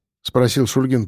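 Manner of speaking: plain reading voice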